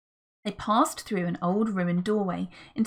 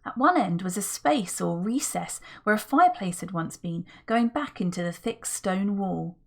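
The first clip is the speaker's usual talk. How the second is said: ordinary delivery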